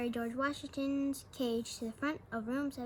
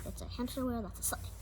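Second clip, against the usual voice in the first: ordinary delivery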